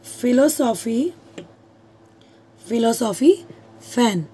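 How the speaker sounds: Philosophy drawn out slowly with a rise; slow example. Shown as a demonstration. Teacher slowly reading an example to her class. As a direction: stretch out philosophy, then फैन. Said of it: "यहाँ देती हूँ मैं".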